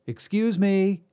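calling out. Acted. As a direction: call out over the clanging. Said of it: "he called over the clanging and chanting".

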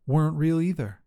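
ordinary delivery

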